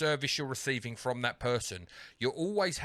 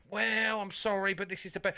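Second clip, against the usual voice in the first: put-on sorry-but-no voice; imitated sales agent; 10 semitones higher than usual. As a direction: refuse regretfully as a call-centre agent. Retold agent refusing a better deal.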